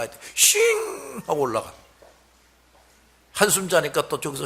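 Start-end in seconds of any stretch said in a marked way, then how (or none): 0.0-1.7 whoosh of takeoff. Retold or imitated. Plane taking off sound.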